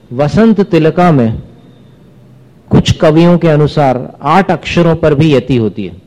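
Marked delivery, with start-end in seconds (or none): none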